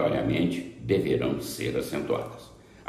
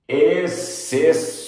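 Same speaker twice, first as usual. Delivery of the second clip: "Exceções" high and very slow, syllable by syllable; emphatic announcement; 9 semitones higher, 3 dB louder, much slower than usual. Shown as a demonstration. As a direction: stretch out every syllable of "Exceções". Teacher announces the exceptions. ("Exceções" bem destacado, sílaba por sílaba)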